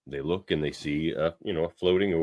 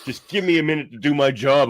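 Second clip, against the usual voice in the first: mock angry snap; 8 semitones higher, 6 dB louder than usual. Lily snapping at Shaxx while she works, mock angry threat. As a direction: snap at him like you're fed up. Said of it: "She's like".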